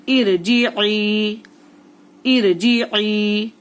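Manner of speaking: "इर जी ई" slowly, final "ई" from mid-throat and held; held ain vowel. Shown as a demonstration. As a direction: stretch the last "ई" from the throat. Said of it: "तो खींचेंगे भी एक अलिफ के बराबर". ("इर जी ई" में आइन हलक से, खींचकर)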